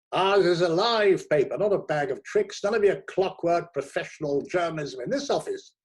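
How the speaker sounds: high and loud booming pep talk; buoyant editor. A narrator's reading. Editor convinces the new critic, loud, high and buoyant. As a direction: sell the idea with gusto.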